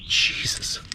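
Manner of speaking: whispered. Real, not acted. Reacting to old Nescafe jar.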